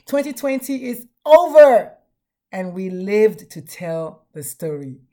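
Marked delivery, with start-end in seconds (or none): 0.1-1.9 loud, high celebratory shout; excited cheer. Real, not acted. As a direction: cheer that the year is over. Celebrating end of year.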